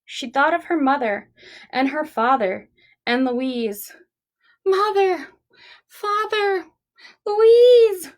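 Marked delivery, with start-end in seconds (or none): 4.7-8.1 high tearful calls, louder on the last name; lost child calling, building up; crying out. Acted. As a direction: call for family through tears. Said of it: "she cried".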